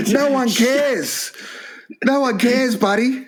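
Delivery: high mock voice, slow, scornful; mocking taunt. Mocking the boring Einstein guest at the wedding, high scornful taunt. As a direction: sneer it down at him, high.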